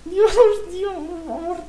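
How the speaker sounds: high, loud anguished cry; desperate cry. Real, not acted. Friend begging as mobs close in, high crying plea. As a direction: wail for mercy.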